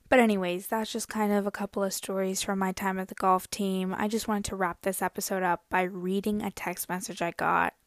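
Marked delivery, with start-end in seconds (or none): none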